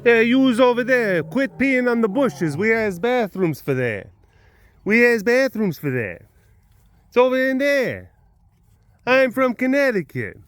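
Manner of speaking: put-on brash Connecticut accent scolding animals. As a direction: scold the lemurs like a Connecticut cop. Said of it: "Connecticut attitude".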